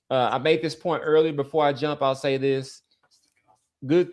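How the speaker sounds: usual speaking voice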